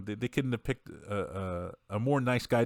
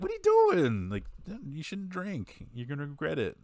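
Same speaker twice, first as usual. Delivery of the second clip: high-pitched, hammed-up disapproval; alarmed mock scolding, easing down from a high squeal; 8 semitones higher than usual. Acting son shocked at mom drinking.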